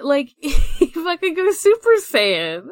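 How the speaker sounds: excited. Real, not acted. Excitedly describing anime scene.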